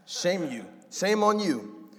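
high, loud, slow shaming shout; a dictator god shaming his child; scolding dictator. Retold or imitated. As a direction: wag the finger and yell it down. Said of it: "his dad was a North Korean dictator".

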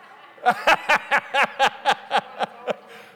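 laughing out loud at the joke; a big open laugh; loud burst of laughter. Real, not acted. Laughing hard at the congregation. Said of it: "I'm trying to preach over here".

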